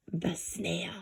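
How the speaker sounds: hushed aside; whisper. Real, not acted. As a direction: whisper it secretly.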